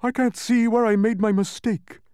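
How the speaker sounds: very high, loud character voice; high, flustered complaint; frantic Humbug. A narrator's reading. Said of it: "frantically rechecking his figures".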